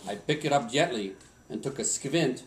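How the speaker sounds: put-on a light Swedish accent telling a Christmas poem. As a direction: recite it like an old Swedish lumberjack. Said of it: "in my best Swedish accent".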